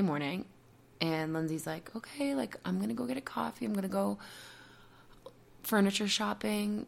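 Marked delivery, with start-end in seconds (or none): 2.0-6.8 softly imitated gentle partner, pitched higher; Lindsay. Partner tenderly announcing coffee and shopping plans, gentle and kind. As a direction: coax kindly in a soft, raised voice. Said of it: "being so gentle, being so kind".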